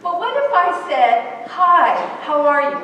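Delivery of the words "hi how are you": louder, deliberate hello that waits for an answer; genuinely interested greeting. Acted. Stopping to look an adult in the eye, interested. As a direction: greet as if you truly want to know. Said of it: "looked into the eye of that adult".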